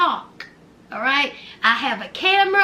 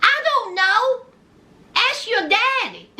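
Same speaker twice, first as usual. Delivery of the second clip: sassy comeback; 8 semitones higher than usual. Real, not acted.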